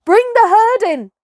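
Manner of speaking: a high, loud herding call; loud command. Acted. Mowgli crying to Akela to drive the buffalo, loud command. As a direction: call it across the herd. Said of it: "he cried".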